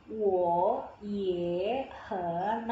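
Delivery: "Wo, ye, hen" separately, each dipping then rising; three third tones. Shown as a demonstration. Teacher counts out three tones, each of "Wo, ye, hen" dipping. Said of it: "di sini kan ada tiga nada".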